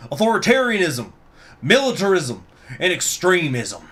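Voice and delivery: put-on high, forceful accented speech; politician's impassioned, accented address; Bush voice. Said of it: "while doing a Bush voice".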